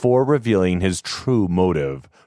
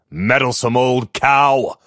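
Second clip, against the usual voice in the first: louder, spat-out contempt; angry insult; 5 semitones higher, 4 dB louder than usual. Acted. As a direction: hurl the insult at her. Master insults the maid.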